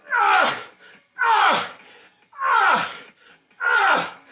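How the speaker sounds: high, loud effortful "ah" cries; hammed-up strain of effort; straining grunts. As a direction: push out each strained "ah". Straining while pushing hard.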